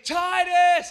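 high loud shout of the name; yelling a name. Retold or imitated. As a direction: call across the store for a lost boy. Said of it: "And I'm just saying".

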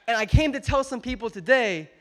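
loud, high, driving sermon peak; preaching at full force. Acted. Pastor urging his congregation toward godly friends, full-force preaching.

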